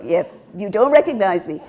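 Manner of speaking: ordinary talk, nothing marked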